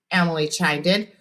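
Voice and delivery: ordinary delivery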